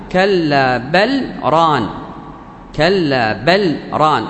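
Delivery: slow كلا بل ران with a short stop after بل; sakt, no merging. Shown as a demonstration. Teacher quoting the exception, بل and ران kept apart by a small silence. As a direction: sound the L of بل clearly, pause, then ران. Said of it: "لوجود السكت".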